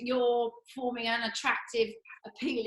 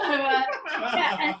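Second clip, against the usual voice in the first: ordinary talk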